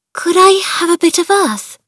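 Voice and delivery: high, trembling timid request; hope against hope, shaking. Acted. Mary begging for a bit of earth, voice trembling. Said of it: "her voice trembling".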